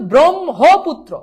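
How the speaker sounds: loud, slow ব্রহ্মপুত্র as "brom... ho... putro"; ব্রহ্মপুত্র drawn out loudly with a full "ho"; emphatic correct pronunciation. Shown as a demonstration. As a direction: hammer out each syllable of ব্রহ্মপুত্র. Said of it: "আবার বলছি".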